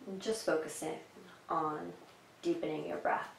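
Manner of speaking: even, calm cues with long silences; unhurried meditation guide, pausing after each instruction; guided meditation. Acted. Guides breathing and relaxing.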